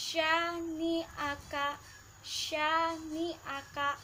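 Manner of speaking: syani'aka slowly with a full sh; slow syani'aka with a heavy sh; thick shin. Shown as a demonstration. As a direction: give the sh in syani'aka weight. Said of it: "Huruf shin harus dibaca tebal".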